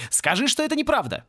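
high, urgent plea to deny it; pleading cry. A narrator's reading. Distraught professor to Порри, pleading cry. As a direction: cry out, begging for reassurance. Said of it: "закричал он".

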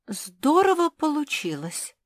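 high-pitched, stunned reaction; mildly dumbfounded high character voice; taken aback. A narrator's reading. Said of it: "опешила Жози".

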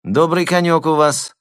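high lisping flatterer. A narrator's reading. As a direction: lisp it up high and sweet. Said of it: "своим шепелявым голосом".

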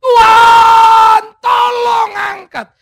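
very high, very loud, slow scream; Paul screaming to God; screamed plea. Acted. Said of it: "dia berteriak".

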